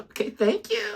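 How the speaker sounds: very high, sweet mock voice; mocked phone voice. Teasing partner about her sing-song phone voice. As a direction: tease her chirpy phone manner. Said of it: "made fun of you".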